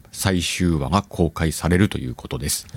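ordinary delivery

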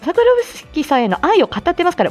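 ordinary talk, nothing marked

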